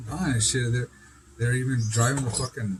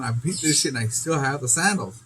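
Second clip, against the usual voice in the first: usual speaking voice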